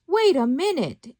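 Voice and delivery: high, loud yell to halt someone; yelled protest. A narrator's reading. Cricket stops Pinocchio running towards the water, yelling. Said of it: "yelled Jiminy Cricket".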